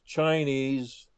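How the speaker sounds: ordinary delivery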